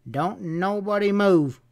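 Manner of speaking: slow, high-pitched drawn-out command; cold order. A narrator's reading. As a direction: order the room to freeze. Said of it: "ordered coldly".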